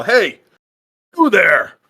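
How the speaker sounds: loud, high challenge across the field; yell. Acted. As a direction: call out to strangers sharply. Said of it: "she's gonna yell".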